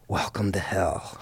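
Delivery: a hint of a dark joke; ominous greeting. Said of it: "shakes my hand and goes".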